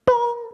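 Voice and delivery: high-pitched "bong" chime; imitated paging chime. Comic imitates office intercom before a page, mock chime. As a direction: voice a short, high PA tone.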